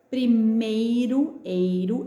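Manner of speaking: "primeiro" drawn out slowly on ei; slow teacher's demo of ei in "primeiro"; vowel-sound demo. Shown as a demonstration. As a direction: stretch the ei in "primeiro", then echo "eiro". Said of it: "esse ei".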